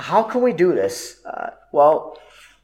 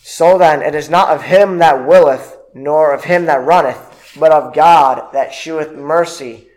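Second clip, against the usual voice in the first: scripture reading; 4 dB louder than usual. Acted. Reads scripture aloud.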